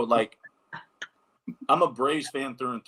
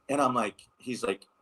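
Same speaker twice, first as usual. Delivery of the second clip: ordinary talk, nothing marked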